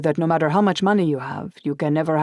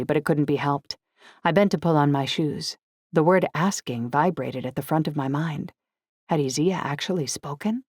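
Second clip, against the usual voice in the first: plain reading voice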